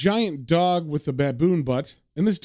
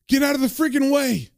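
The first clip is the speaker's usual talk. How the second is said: urgent shout; 9 semitones higher than usual. Acted. Frank voiced, shouting.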